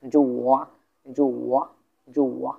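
"জো ওয়া" dipping down, then rising; teacher's dip-and-rise tone on "জো ওয়া"; third tone. Shown as a demonstration. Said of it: "উপর থেকে নিচে".

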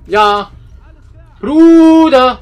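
laut gebrüllter Frust; brüll es raus wie ein Gamer; wütender Schrei. Real, not acted. Frust im Spiel, laut geschrien. (wütend schreiender Spieler)

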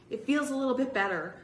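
lifted, quick, mildly surprised. A narrator's reading. Boy testing his hurt ankle, quick high mild surprise. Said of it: "he said, surprised".

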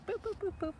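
high, light boops; little bouncing sound effect; bouncy hopping noise. Acted. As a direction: hop along in high staccato notes. Goat hops away over bridge.

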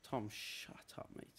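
hushed whisper to a mate nearby; whispered aside. Real, not acted. Streamer whispering to his friend Tom off camera. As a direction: whisper it so the stream barely hears.

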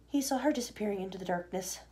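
ordinary delivery

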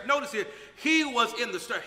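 ordinary talk, nothing marked

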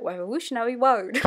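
"Revolutionary Road" with every R turned into a W; "Revolutionary Road" said as "Wevolutionawy Woad"; Jonathan Ross lisp. Retold or imitated. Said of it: "he pronounces it Revolutionary Road".

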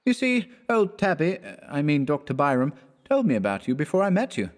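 high-pitched, light student voice; airy, high voice for a joking friend; jaunty young student. Acted. Voices Clifford in the story.

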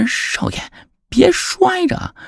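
very high, slow pleading; anxious servant. Acted. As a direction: beg him not to fall. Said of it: "焦急地说". (老仆人焦急地求)

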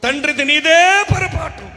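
"నీదే" stretched high and loud; shouted blame. Real, not acted. Preacher turning the blame on the father, shouted stress on "నీదే".

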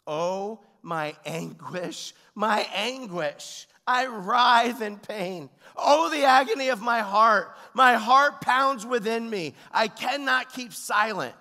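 slow, building to a high, loud cry; impassioned, drawn-out declamation as God; God's anguish. Acted. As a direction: cry out scripture in agony, voice swelling. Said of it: "where God says".

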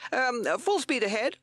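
high, loud shouted command; Captain Beaky shouting. A narrator's reading. Captain to his crew, shouting orders. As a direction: call out orders at high pitch. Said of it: "he shouted".

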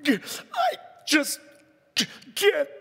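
too choked to finish the words; choked-up crying. Retold or imitated. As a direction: voice cracking with sobs. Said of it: "You're like".

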